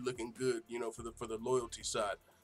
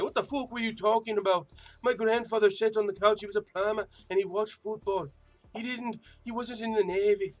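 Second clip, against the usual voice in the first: put-on higher-pitched Irish accent, indignant rebuttal; McGregor Irish accent; 8 semitones higher, 4 dB louder than usual. Vlogger imitating Conor at the press conference in an Irish accent. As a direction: fire back in an Irish brogue like a fighter denying rumors. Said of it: "my first McGregor accent".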